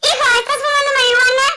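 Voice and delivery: high, loud, fast mom line with laughter; laughing mom voice. Acted. Mother scolding son, fast, laughing, high. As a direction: rush the mother's scold while giggling.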